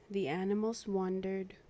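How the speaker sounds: usual speaking voice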